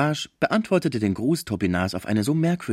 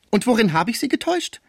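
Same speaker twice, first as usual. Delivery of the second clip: loud, much higher challenge; defiant, hands on hips; indignant; 14 semitones higher, 5 dB louder than usual. Acted. Cibot challenging Fraisier, loud and high.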